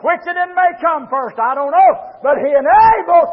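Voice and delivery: loud, high, ringing preacher's cry; full-force preaching. Acted.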